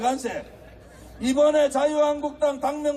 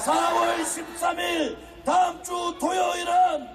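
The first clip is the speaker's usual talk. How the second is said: fiery rally speech; 4 semitones higher than usual. Acted.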